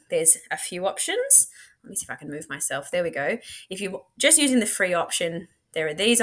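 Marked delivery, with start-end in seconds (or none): none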